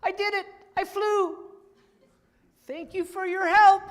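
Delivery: high, loud and thrilled; high happy pig cry; excited Piggy. Acted. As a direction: squeal with joy at the triumph. Piggy thinks she flew.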